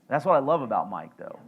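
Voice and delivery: usual speaking voice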